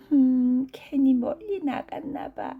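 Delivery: high, fairly loud cat voice; cat muttering to itself. Acted. Tired rained-on cat regretting the long walk, high-voiced muttering aloud. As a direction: voice the cat's regret, pitched well up. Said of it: "고양이는 작게 중얼거렸어요".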